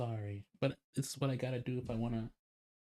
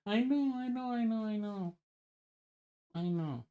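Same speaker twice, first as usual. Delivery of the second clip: higher, sing-song I know, I know to soothe her; playfully mock-soothing, pitched up; high placating voice; 11 semitones higher, slower than usual. Reassuring his annoyed partner Ina, in a high mock-soothing voice.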